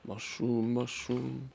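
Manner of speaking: ordinary delivery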